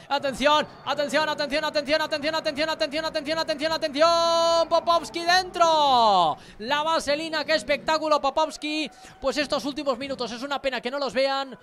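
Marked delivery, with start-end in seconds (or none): 0.1-8.9 rising, louder repeated calls; building excited call. Real, not acted. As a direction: build the tension to the goal. Building tension before goal. (tensión creciente hasta el gol)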